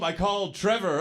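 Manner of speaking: ordinary talk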